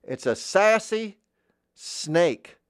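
high, loud sassy voice, slowly stretched; sassy character voice. Shown as a demonstration. Asked to voice a sassy snake, high sass. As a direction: pitch SASSY way up and draw it out. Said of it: "Another voice I like to do".

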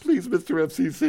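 high, pleading mock panic; impression of Ken. Host mocking his scared co-host before the FCC, high pleading impression. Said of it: "My quick impression of Ken".

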